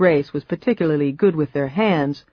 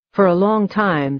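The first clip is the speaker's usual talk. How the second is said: plain reading voice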